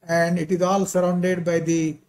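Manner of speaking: ordinary talk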